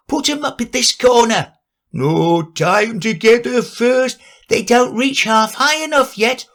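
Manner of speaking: high, busy little animal voices; bustling crowd of small creatures calling out; workers' voices. Acted. Animals shouting with ladders.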